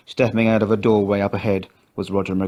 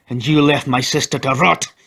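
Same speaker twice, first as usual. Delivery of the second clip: higher, louder furious yell; 5 semitones higher, 4 dB louder than usual. A narrator's reading. McGrath pinning Lloyd by the throat, yelling. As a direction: bellow it down at him. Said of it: "he yelled".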